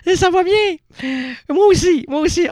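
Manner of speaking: high, loud showman hello; loud stage greeting. Acted. Host greeting the audience, high and loud. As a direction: belt the greeting to the crowd.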